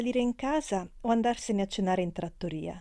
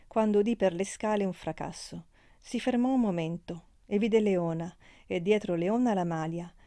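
ordinary delivery, nothing marked

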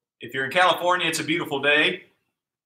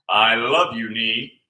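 tender to the knee; 6 semitones lower, slower than usual. Pretend. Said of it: "tell it you love it".

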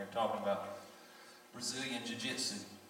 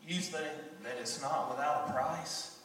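rising louder and higher toward the climax; building preaching; 7 semitones higher, 9 dB louder than usual. Acted. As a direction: build the sermon to a peak.

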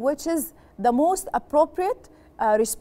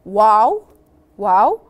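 excited wow; 4 semitones lower, 6 dB louder than usual. Shown as a demonstration. Teacher models happy-news reply.

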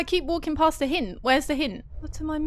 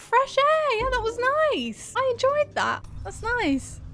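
relieved delight; 10 semitones higher than usual. Real, not acted. Relief after scary part.

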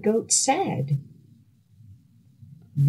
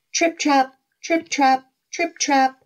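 trip trap; 7 semitones higher than usual. Acted. Goat crossing the bridge.

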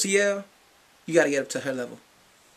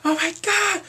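high, loud squeal of delight; excited; 14 semitones higher, 8 dB louder than usual. Real, not acted. Fan squealing about the concert, excited. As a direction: burst out thrilled.

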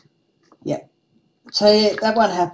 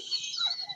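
a high squealing real laugh; burst of high laughter; 13 dB quieter than usual. Real, not acted. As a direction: let a helpless laugh escape. Friend's brother's joke lands.

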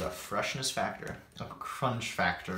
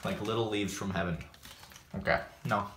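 chewing while talking; eating salad between words; mouth full; 3 dB quieter than usual. Real, not acted. Seen 0: just forked salad to mouth; cheeks move as he talks.

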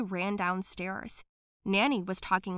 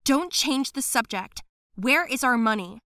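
firm demand; 3 semitones higher, 6 dB louder than usual. Acted. Girl confronts the nanny.

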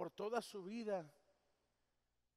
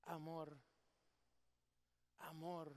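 soft tender plea; 5 semitones lower, 10 dB quieter, slower than usual. Real, not acted.

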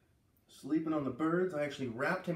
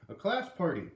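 excited; 5 semitones higher, 5 dB louder than usual. Acted. Teacher announces party.

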